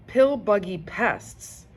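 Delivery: ordinary delivery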